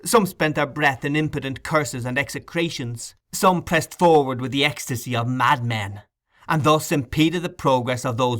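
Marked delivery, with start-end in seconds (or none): none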